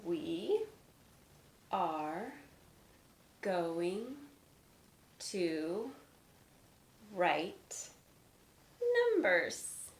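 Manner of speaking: each word slow and separate. Shown as a demonstration. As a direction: dictate one word at a time. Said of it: "teacher spelling out instruction".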